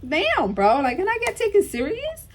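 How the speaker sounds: high-pitched, put-upon whine of disbelief; exasperated plea. Retold or imitated. Caller fed up with the manager handoff, exasperated plea. Retold exasperation on complaint call.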